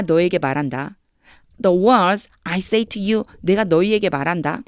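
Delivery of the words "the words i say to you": the whole phrase read very slowly, word by word. Shown as a demonstration. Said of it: "한번 해볼게요". (문장 전체를 아주 천천히 읽기)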